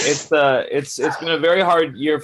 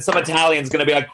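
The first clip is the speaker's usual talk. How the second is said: usual speaking voice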